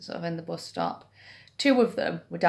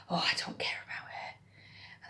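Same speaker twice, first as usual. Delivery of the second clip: put-on hushed, slow whisper of a gossiping old lady; 14 dB quieter than usual. Old lady whispering gossip about Carol. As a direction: whisper it like secret bus stop gossip.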